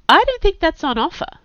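high, arch retort. A narrator's reading. Inga rebuffing Kurt's crude remark, archly. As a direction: answer him archly, pitched well up. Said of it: "she said archly".

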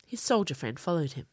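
plain reading voice

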